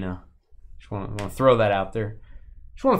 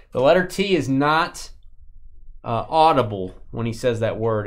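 usual speaking voice

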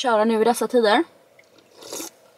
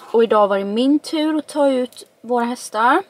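ordinary delivery

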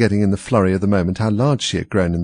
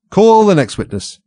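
king calling out; 11 semitones higher, 7 dB louder than usual. A narrator's reading. King calls next witness.

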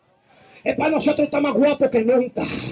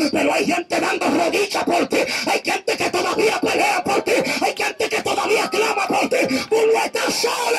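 climbing higher, declaring; preacher at full force, higher; fervent preaching; 6 semitones higher than usual. Acted. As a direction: build to the promise.

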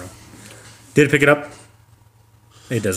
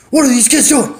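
much higher, mock angry; gruff dad; 12 semitones higher, 4 dB louder than usual. Dad coming out to noisy kids, mock angry. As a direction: demand it gruffly. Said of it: "he's like".

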